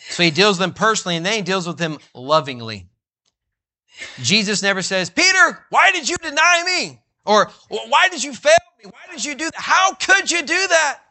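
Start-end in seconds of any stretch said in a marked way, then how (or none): 5.1-11.0 high, loud, indignant demand; a hurt, accusing master scolding his friend; mock accusing. Jesus rebuking Peter, high and sharply accusing. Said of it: "He doesn't respond like that to Peter".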